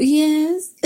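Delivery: put-on little girl voice. Daughter answering her mother, high and eager like a little girl. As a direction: chirp it like an obedient child. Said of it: "turn back into those little girls".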